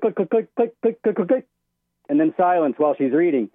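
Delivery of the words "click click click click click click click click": quick higher clicks, mimicking typing; imitated keyboard clicks. Caller mimics the judge typing in the silent courtroom, light clicking sounds. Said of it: "All you hear is click".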